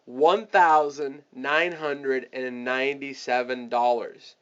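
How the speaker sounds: "one thousand nine hundred and ninety-seven dollars" drawn out word by word; spelled out slowly. Shown as a demonstration. Salesperson quoting the full price to a client, slow and weighty. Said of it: "you have to say it like this".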